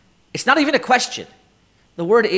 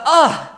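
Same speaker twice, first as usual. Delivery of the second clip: high, louder groaning cry, demonstrated; demo groan; 9 semitones higher, 4 dB louder than usual. Shown as a demonstration. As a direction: give the krechts as a pained little cry. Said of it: "a shout, like a, like a".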